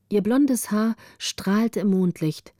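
plain reading voice

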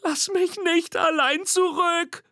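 very high, slow squeaky plea; pleading call. A narrator's reading. Little monster calling after Nina, high squeaky plea. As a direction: call after her, scared to be left. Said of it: "ruft Mimi".

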